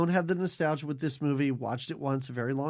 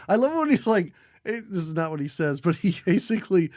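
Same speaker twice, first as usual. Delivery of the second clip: normal talking voice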